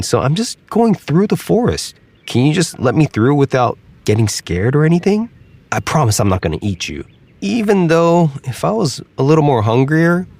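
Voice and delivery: half-teasing promise of safety; alligator voice. Acted. Alligator insists he's harmless.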